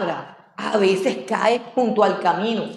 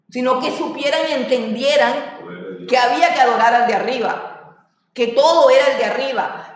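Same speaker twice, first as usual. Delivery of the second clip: ordinary talk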